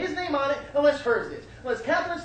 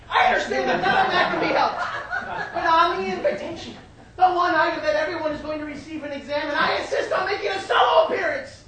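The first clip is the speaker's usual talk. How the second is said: high, loud declaration; raised, emphatic theatrical voice; insistent mother; 5 semitones higher, 4 dB louder than usual. Acted. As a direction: lay down the law as an offended mother.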